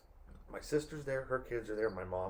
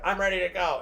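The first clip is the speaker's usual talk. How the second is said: mocking the sister; 7 semitones higher, 7 dB louder than usual. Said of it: "it's like".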